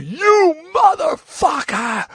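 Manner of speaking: high, drawn-out mock battle cry; enraged shout. Storming into Howard's dressing room, enraged shout. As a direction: bellow it long and high, storming in. Retelling bursting in to confront Howard.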